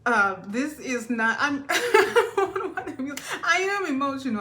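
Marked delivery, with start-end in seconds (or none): 1.6-4.4 higher, laughing while admitting it. Real, not acted. Laughing as she admits to viewers she is emotional. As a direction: chuckle through the confession. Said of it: "I'm laughing".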